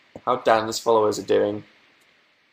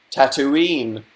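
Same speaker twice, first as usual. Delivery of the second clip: usual speaking voice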